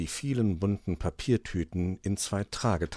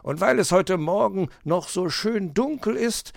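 higher fox voice, eager and proud; proud fox explaining; 12 semitones higher, 5 dB louder than usual. Acted. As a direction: show off the new switch. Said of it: "erklärte er".

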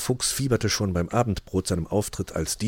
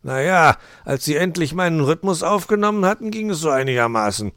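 raised fox voice, easing down; grudging retort; 9 semitones higher, 6 dB louder than usual. A narrator's reading. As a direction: concede it loudly, then let it drop.

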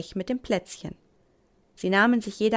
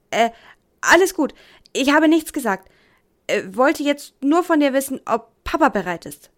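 flustered; 5 semitones higher, 7 dB louder than usual. Acted. Caught out, covering up.

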